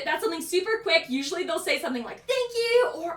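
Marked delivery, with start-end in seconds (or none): 2.2-2.9 high, chirpy imitated customer; lightly acted gratitude; pleased thanks. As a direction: accept a compliment with raised pitch.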